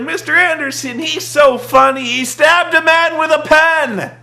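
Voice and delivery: high, loud gushing fan voice; hammed-up fan delight; mock adoring fan. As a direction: gush like a clueless fan. Mocking adoring crowd.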